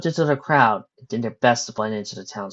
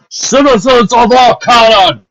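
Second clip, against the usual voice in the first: loud, slow booming command to the town; booming captain addressing the crowd; 12 semitones higher, 14 dB louder, slower than usual. A narrator's reading. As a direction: boom it out like a captain. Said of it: "he boomed".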